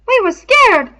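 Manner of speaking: high, loud, eager rush; excited. A narrator's reading. Piggy excitedly offering Ralph an excuse, high and loud. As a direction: blurt it out as a way out. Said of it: "said Piggy excitedly".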